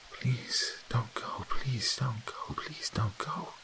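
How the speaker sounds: high, slow, breathless whispered pleading; a ghost whispering from behind the door; eerie ghostly plea. A narrator's reading. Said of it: "an inhuman sobbing".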